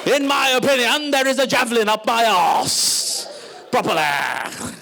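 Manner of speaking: put-on slow, pompous, over-proper Indian English accent; Shashi Tharoor-Karan Thapar accent. Mocking New India's anglophiles, pompous British-Indian accent. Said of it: "sound like a love child of Shashi Tharoor and Karan Thapar".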